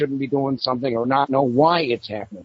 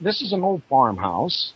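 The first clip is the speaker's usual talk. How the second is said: normal talking voice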